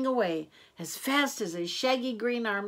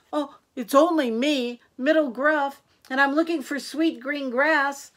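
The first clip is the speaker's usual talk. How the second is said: louder, higher goat voice; Middle Gruff's voice; 6 semitones higher, 6 dB louder than usual. A narrator's reading. Middle Gruff answers the troll, high loud voice.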